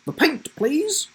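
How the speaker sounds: high, slow and prim; prim teacher. Acted. Teacher asks Isaac, high slow prim. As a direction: order it coolly.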